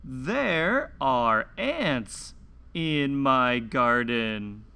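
slow, clear picture-book read-aloud with long pauses; reading to children. Acted. Host reading a garden picture book to young children, slow and clear. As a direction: read each page slowly for little listeners.

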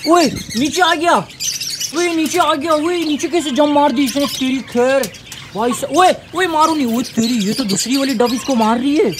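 high, urgent exclamations of alarm; real panic as the duck attacks the chick; alarmed excitement. Real, not acted. Vlogger sees a duck attack the chick, shouting in alarm.

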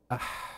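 أح with the breath running on after it; رخاوة: أح بجريان الصوت; flowing haa. Shown as a demonstration. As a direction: let أح trail out in a stream of air. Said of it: "لما قلت أح، في جريان للصوت".

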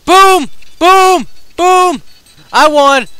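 loud high boom; triumphant shout. Real, not acted. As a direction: cheer the win. Celebrating win.

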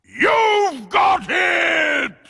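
very high, very loud, slow scare shout; jump-scare shout; sudden shout. A narrator's reading. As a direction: yell it to make the listeners jump. Jump-scare ending of ghost story.

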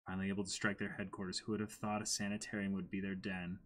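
soft quick reading of the page; reading aloud. Acted. Reading the dialogue aloud to co-host, soft read. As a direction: read the lines softly, trailing away.

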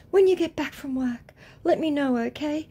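high, put-on sweet-talk voice; mock a lovey-dovey texter in a high voice; gushing love-bomber. Scammer love-bombing the woman, gushing high.

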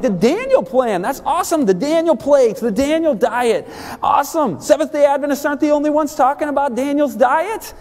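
high, excited near-shouting retelling; thrilled. Retold or imitated. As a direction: bubble over with delight at the book. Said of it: "And I was thrilled".

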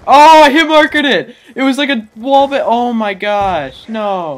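loud, thrilled yell; excited shout. Real, not acted. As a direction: shout it out in pure hype. Hype over a big shot.

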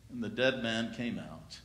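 usual speaking voice

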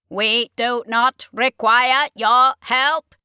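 loud, slow Dalek rejection. Acted. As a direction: grind it out like a Dalek.

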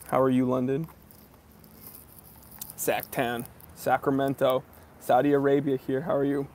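ordinary talk, nothing marked

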